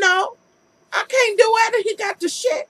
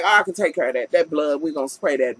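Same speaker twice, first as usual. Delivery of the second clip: lightly lowered dude voice, casual and sure of himself; repairman voice; 11 semitones lower, faster than usual. Retold or imitated. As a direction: voice the repairman a touch lower, unbothered by the blood. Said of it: "And he's like".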